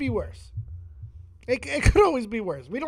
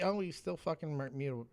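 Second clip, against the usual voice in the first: ordinary talk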